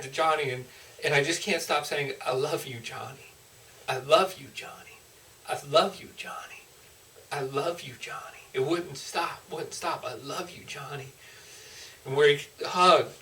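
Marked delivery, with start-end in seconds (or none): 2.2-8.4 soft, tender repeats, fading on the name; tender father's love. Retold or imitated. Speaking the father's love to Johnny. 10.0-11.1 soft, tender repeats, fading on the name; tender father's love. Retold or imitated. Speaking the father's love to Johnny.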